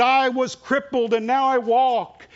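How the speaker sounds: high, loud, slow proclamation; healed man's testimony. Acted. Healed cripple proclaiming at the city wall, high and slow. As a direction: testify with solemn joy. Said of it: "And the next man said".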